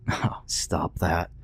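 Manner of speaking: slow, soft, embarrassed protest; shy mumble, wanting her to stop; blushing. Acted. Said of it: "he said with a blush".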